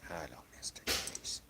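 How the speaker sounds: soft unvoiced whisper to the cat; whispered hello. Real, not acted. Streamer greeting his cat, soft whisper. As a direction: breathe it out in a whisper.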